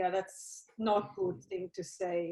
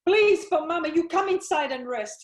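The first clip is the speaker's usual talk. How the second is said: loud and higher, pleading through distress; tearful begging; 6 semitones higher, 9 dB louder than usual. Acted. Mother begging her daughter to come inside and rest, pleading.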